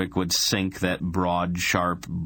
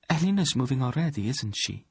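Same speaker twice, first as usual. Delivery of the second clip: queen voice; 8 semitones higher than usual. Acted. Queen Poran speaks.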